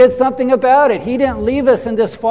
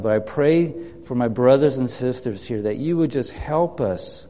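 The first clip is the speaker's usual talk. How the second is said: hushed prayer; 8 semitones lower, 8 dB quieter than usual. Acted. Pastor praying softly over the congregation, low and hushed. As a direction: pray gently over them, softly.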